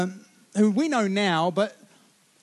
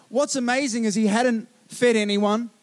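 ordinary talk, nothing marked

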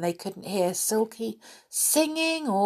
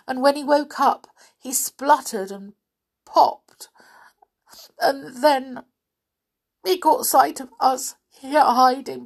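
higher, tearful fairy voice; Silky, a fairy, lightly voiced and tearful; 6 semitones higher, 3 dB louder than usual. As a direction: tell it as a fairy close to tears. Silky voiced high throughout.

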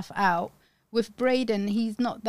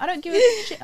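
louder, higher shout of fed-up mum; angry retold yell; 10 semitones higher, 6 dB louder, much faster than usual. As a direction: snap it like a furious mother.

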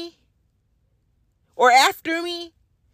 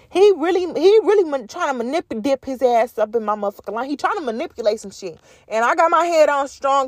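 normal talking voice

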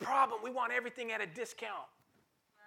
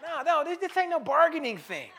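louder and higher, insistent; raised preaching voice; 6 semitones higher, 7 dB louder than usual. Acted. Preacher rejecting bargaining, raised and insistent.

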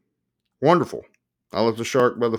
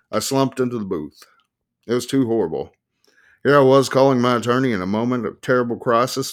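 ordinary delivery

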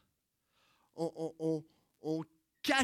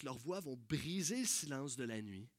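ordinary talk

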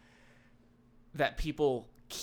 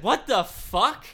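louder, higher retold what the fuck; slow, loud mock outrage; re-living a near miss in the parking lot, mock angry; retold outburst; 7 semitones higher, 8 dB louder, slower than usual. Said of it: "I said".

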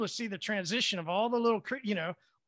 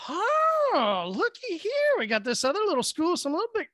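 acted delighted discovery; 8 semitones higher, 3 dB louder than usual. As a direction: pipe up high, thrilled at the find. Acts out spotting more fish.

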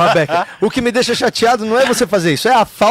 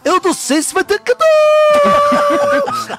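very high, very slow laughing squeal; Gugu impression; much slower than usual. Comedian voices Gugu giving up, high laughing squeal.

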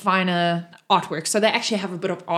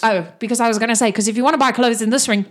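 fast chatter; 5 dB louder, much faster than usual. Acted. Toriel rattles on.

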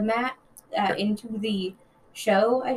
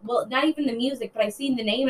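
ordinary delivery

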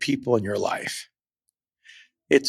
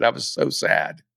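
sad; 8 semitones lower than usual. Retold or imitated. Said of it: "He said".

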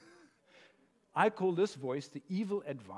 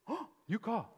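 observant voice; 5 semitones higher, 5 dB quieter than usual. Acted. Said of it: "the observant voice says".

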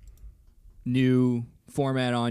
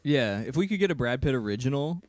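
ordinary delivery

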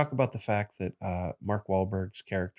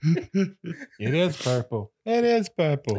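laughing; 8 semitones higher, 5 dB louder, much slower than usual. Real, not acted.

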